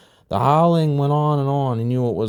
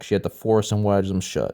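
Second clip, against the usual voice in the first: ordinary delivery, nothing marked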